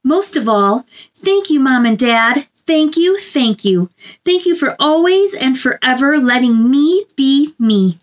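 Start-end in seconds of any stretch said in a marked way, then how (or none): none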